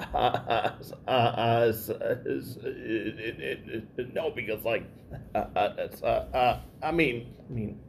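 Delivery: repeated sound blocks, halting; stuck on repeated syllables; stuttering. Real, not acted. Speaker who stutters, blocking.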